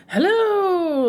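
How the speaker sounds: loud, high, drawn-out hello; comic high whooping hello; weirdly funny greeting. Retold or imitated. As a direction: sing out a goofy, high-pitched greeting. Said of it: "weirdly funny".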